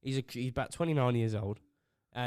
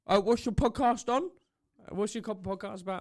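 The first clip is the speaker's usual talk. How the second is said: imitating coworker; 7 semitones higher, 4 dB louder than usual. Coworker voiced in story.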